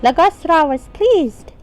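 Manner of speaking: high-pitched pixie storyteller. Acted. Game player reading ancient tomb history to viewers, pixie voice. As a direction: read the chronicle in a high pixie voice. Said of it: "done with the pixie voice".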